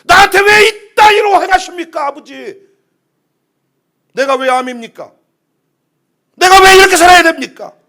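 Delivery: loud, high outburst at father; rebellious protest. Acted. Child lashes out at father, loud rebellious protest. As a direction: cry out as an indignant child. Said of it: "이때 자식은 튕겨나갑니다".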